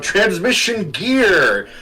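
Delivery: heroic shout. Acted. Player calling a transformation as the armored hero, heroic shout. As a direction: call it out high, stretching the words. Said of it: "and I shout".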